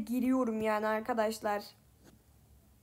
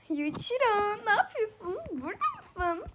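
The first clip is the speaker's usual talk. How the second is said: put-on higher, cheerful girl voice; happy girl voice; 7 semitones higher than usual. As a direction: sound bright and happy. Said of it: "Daha mutlu bir ses kullanıyorum Akane'de".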